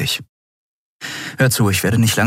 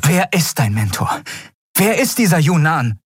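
cold threat; 9 semitones higher than usual. A narrator's reading. Endriel threatens Kai.

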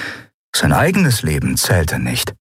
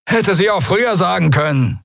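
high, rasping sneer; snarling Draxyl; 12 semitones higher than usual. A narrator's reading. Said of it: "schnarrte er".

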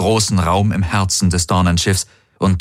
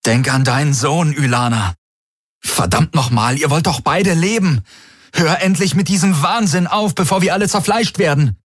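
high-pitched desperate outburst; urgent pleading; 9 semitones higher than usual. A narrator's reading. Galen to Ylana before shooting starts, urgent pleading. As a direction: build higher from appeal to exasperated demand.

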